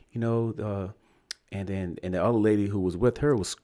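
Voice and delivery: ordinary delivery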